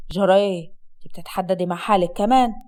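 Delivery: low-pitched Upper Egyptian husband; scornful husband. Acted. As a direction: drop low and taunt the wife. (زوج صعيدي ساخر بصوت واطي)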